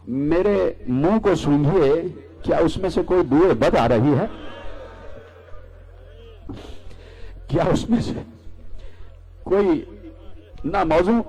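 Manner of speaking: put-on a child's hesitant plea, broken by long pauses; hurt child. Little Hussain asking his mother, hurt and halting.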